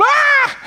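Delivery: very high mock shriek; startled cat scream. Preacher acting the cat startled by a bath toy, mock scream. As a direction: squeal in comic fright. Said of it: "bath toy squeaks in the bathtub".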